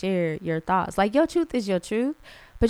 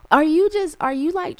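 exasperated challenge; 8 semitones higher, 6 dB louder than usual. Real, not acted. Confronting a judgy commenter.

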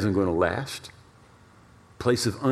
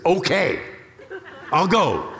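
higher and louder, giving up the fight; exasperated giving in; 8 semitones higher, 4 dB louder, slower than usual. Retold or imitated. Husband finally giving in to his wife, exasperated. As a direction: throw up your hands and say it.

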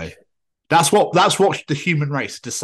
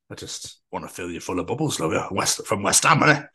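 creepy London geezer; 6 semitones lower than usual. Pretend. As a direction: play a creepy cockney fan. Voiced West Ham fan.

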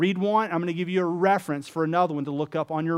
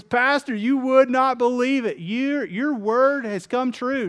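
excited; 7 semitones higher, 4 dB louder, slower than usual. Acted. Rich Frank thrills the pastor, loud excited gush. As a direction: voice jumps high and loud with glee.

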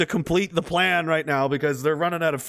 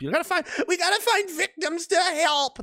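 mock do-gooder; 13 semitones higher than usual. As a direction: pitch it way up and drag it out piously. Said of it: "That's the left all the time".

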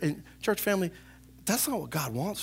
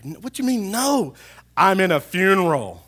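higher, incredulous protest; retold indignation; 5 semitones higher, 9 dB louder than usual. Pastor recalling a funeral interruption, indignant. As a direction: push back, louder as it goes. Retelling an annoyed exchange at funeral.

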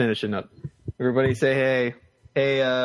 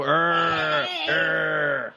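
two drawn-out, higher-pitched creature growls; Bigfoot sound; 4 semitones higher than usual. Shown as a demonstration. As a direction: show a child how Bigfoot growls. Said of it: "make a Bigfoot sound".

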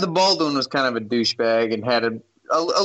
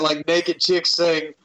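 ordinary talk

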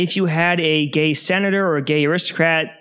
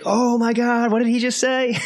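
higher, scandalised gasp of disbelief; playing an astonished onlooker, pitched up; shocked crowd; 7 semitones higher than usual. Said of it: "And everybody's just like".

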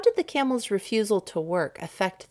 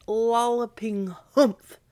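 slow and drawn out; 5 semitones higher, 4 dB louder, slower than usual. A narrator's reading. Hump swells, drawn out.

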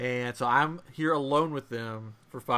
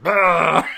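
loud retching "bah"; hammed-up puke noise with laughter; imitated vomiting; 6 semitones higher, 7 dB louder than usual. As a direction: mimic a child heaving over a toilet. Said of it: "he's like".

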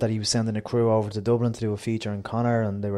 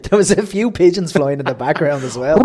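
laughing; 5 semitones higher, 10 dB louder than usual. Real, not acted. Laughing at his own joke.

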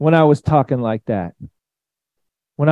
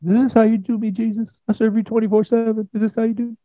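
high, small put-on voice; mock aggrieved whine; 8 semitones higher, faster than usual. Football player blaming Jesus after a dropped ball, mock whine.

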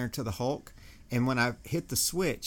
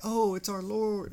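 mock reverent; 8 semitones higher than usual. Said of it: "it's not like I was like".

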